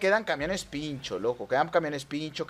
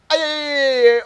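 high, loud cries gliding down into olé; yelp, then draw out the olé; 9 semitones higher, 8 dB louder than usual. Real, not acted. Player dodging an obstacle, high yell into long olé.

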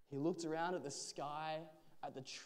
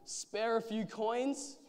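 slow, raised beggar's call; higher-pitched street plea; 7 semitones higher, 4 dB louder, slower than usual. A narrator's reading. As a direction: call out for alms, drawn out. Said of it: "rattled his bowl and called out".

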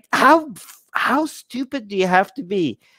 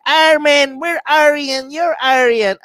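mock nationalist fan; 8 semitones higher than usual. Mocking North Indian fans who tell him he's one of them, hammed-up. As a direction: ham up a pushy fan claiming him. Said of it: "messages I got from North Indian people".

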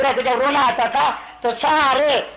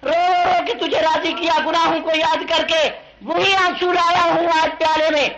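high, sustained sermon chant; impassioned pulpit recitation; fervent preaching; 4 semitones higher than usual. Acted. Preacher voices Gabriel's answer fervently.

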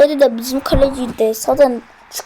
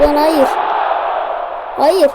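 shout of no; slower than usual. Real, not acted. Conceded goal, shouts no.

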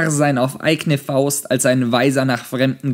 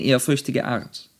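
plain reading voice

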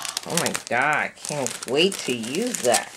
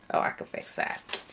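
ordinary talk, nothing marked

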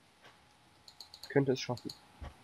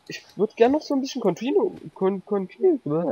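excited squeal; 9 semitones higher, 7 dB louder than usual. Real, not acted. Excited squeal while playing.

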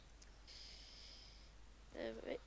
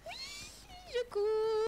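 high panicked cry while fleeing; 5 dB louder, much slower than usual. Real, not acted. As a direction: scream it in real panic. Chased by zombies, panicking.

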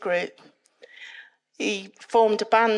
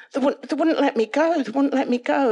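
louder, higher, urgent replay; retold distress; 5 semitones higher, 6 dB louder than usual. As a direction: relive being held fast, urgently. Retells being pulled in nightmare.

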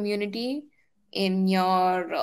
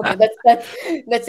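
laughing through the words; breaking into a laugh mid-sentence; 3 semitones higher, 7 dB louder than usual. Real, not acted. Laughing at own nightmare story.

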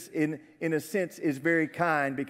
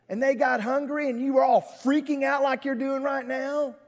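high-pitched incredulous reminding; exasperated chastising; 6 semitones higher than usual. Acted. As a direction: scold them with reminders, mildly fed up. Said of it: "I would have kind of chastised him".

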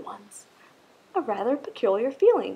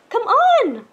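high, loud call; 13 semitones higher, 11 dB louder than usual. A narrator's reading. Other fish calling Rainbow Fish, high loud call. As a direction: call him over to play.

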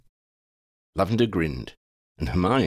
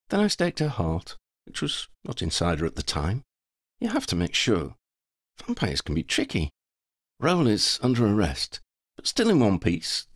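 lightly voiced heroine, often pitched up; Lavender, matter-of-fact; 7 semitones higher than usual. Lavender reports on the Countess fight, matter-of-fact. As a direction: recount it in character, breezily.

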